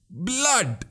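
high, thin mock shriek of a bloodthirsty dagger. Vandiyathevan voices his thirsty dagger to Nambi, high mock shriek. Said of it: "it shrieks".

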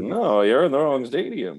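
slow, loud, laughing through it. Real, not acted. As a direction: giggle as you tell him off. Laughing at the joke.

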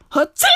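sudden mock sneeze; imitated sneeze. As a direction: act out a stranger's sneeze. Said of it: "Some lady fucking sneezed".

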